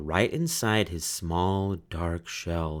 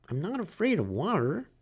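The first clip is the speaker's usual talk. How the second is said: high, timid little turtle voice; small turtle Franklin; 10 semitones higher, 3 dB quieter than usual. A narrator's reading. Franklin asks the duck for help, high and timid. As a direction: ask like a shy child.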